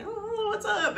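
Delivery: lightly high, airy hello; high mock greeting. Quoting Laurel, a lightly high casual hello. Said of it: "she's like".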